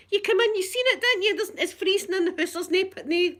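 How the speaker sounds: high-pitched exasperated argument; raging. Retold or imitated. Customer arguing there's no heating, raging. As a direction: re-enact a heated telling-off. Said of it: "I was absolutely raging".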